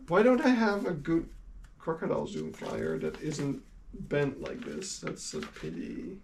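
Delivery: ordinary talk, nothing marked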